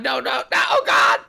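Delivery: loud panicked no no no; panicked shout. Real, not acted. Shuttle took him against will.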